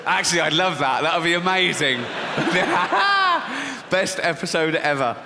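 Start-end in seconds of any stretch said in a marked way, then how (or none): 2.1-3.9 loud, high burst of laughter; big real laugh. Real, not acted. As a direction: laugh out hard at your own joke.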